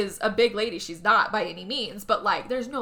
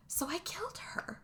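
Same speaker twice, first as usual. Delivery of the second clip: hushed whispered admission, dropping the voice; mock-sinister whisper of a confession; 7 semitones higher, 13 dB quieter than usual. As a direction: breathe it like a dark secret. Wife confessing a killing.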